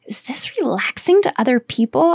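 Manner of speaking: incredulous whispered question; bewildered whisper. Retold or imitated. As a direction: ask it under your breath, puzzled. Puzzled aside after creepy ASMR.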